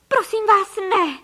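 high, loud pleading cry; mother crying out to save her children; desperate cry. A narrator's reading. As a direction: beg at the top of her voice. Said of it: "vykřikla paní Frejková".

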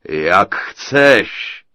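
loud giant chuckle in the words; chuckling giant. A narrator's reading. Giant mocking the girl's refusal, loud and chuckling. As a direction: laugh it off like a smug giant. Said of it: "zachechtal se obr".

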